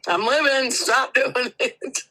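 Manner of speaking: high, loud, drawn-out comic protest; mock pleading yell. Listener playing the revived patient to medics, mock pleading yell. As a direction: holler it in pretend desperation.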